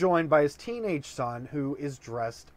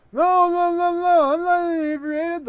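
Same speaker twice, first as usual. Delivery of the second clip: much higher and louder protest; shrill mock protest; frantic denial; 7 dB louder than usual. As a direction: insist you're not drunk, hammed-up panic. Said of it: "The father says".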